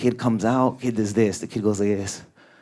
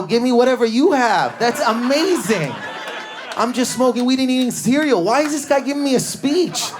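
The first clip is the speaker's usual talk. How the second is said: put-on excited kid; 12 semitones higher, 3 dB louder than usual. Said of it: "I go".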